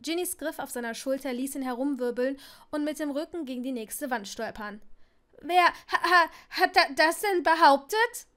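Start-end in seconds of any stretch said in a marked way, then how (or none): 5.4-8.3 panicked stammer. Acted. Neville stottert panisch.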